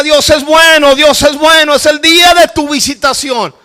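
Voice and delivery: loud, high, fervent proclamation; exultant preacher's shout; retold shouting. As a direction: shout good news to a man across the way. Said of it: "Si él está gritando del hoyo de enfrente".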